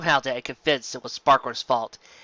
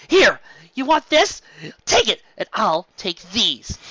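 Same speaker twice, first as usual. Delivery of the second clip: loud, high, fed-up yelling; angry shout; 6 semitones higher, 6 dB louder than usual. Acted. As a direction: fling the words at her. Sparkler angrily tossing necklace.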